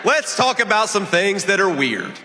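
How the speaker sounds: high, projected stump-speech delivery; rally speech. Real, not acted. Candidate attacks the opposing party before a crowd, rally speech. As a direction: address the crowd in measured, emphatic phrases.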